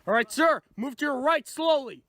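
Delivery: loud, firm police orders; shouting commands. Acted. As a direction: bark each step at the suspect. Officer shouting commands at suspect.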